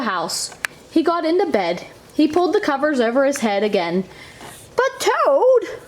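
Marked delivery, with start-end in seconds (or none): 4.8-5.8 much higher, pleading Frog; cried out pleading. A narrator's reading. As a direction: protest to a friend going back to bed. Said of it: "cried Frog".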